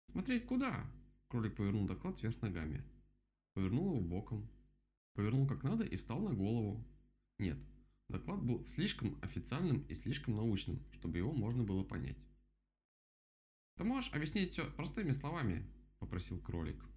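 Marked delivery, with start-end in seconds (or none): 0.1-0.8 very high-pitched rabbit question; puzzled rabbit. A narrator's reading. Rabbit is shown a report, puzzled high voice. 13.8-15.6 high-pitched storybook rabbit; voiced rabbit character. A narrator's reading. Rabbit asks for plain words, high rabbit voice.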